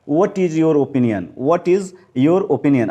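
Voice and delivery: slow, careful teacher reading; slow model sentence. Shown as a demonstration. Teacher reads the sentence slowly to the class. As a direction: spell it out slowly for learners. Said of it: "what लोग पढ़ते हैं".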